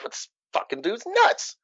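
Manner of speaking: high, soft, slow inner thought; retold disbelief. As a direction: voice a private incredulous reaction. Voices own disbelieving inner thought.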